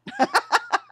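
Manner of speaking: sudden high-pitched burst of laughter. Real, not acted. Host amused by a chat message, high laugh.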